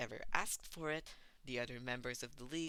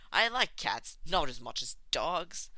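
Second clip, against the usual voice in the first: loud cheerful voice; 3 semitones higher, 10 dB louder than usual. Acted. Twice loud.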